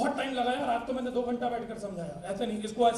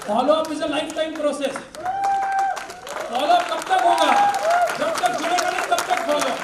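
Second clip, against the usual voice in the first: loud, high rallying cry; rousing shout; 6 semitones higher, 10 dB louder than usual. Real, not acted. Speaker rallies trainees to keep following up, shouting. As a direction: shout the slogan to fire up the crowd. Slogan shouted to cheering audience.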